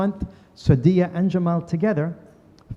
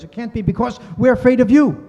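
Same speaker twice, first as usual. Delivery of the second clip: higher, louder disbelief; incredulous quote; 7 semitones higher, 6 dB louder than usual. Retold or imitated. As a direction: insist it can't be true. Jamal insisting in disbelief.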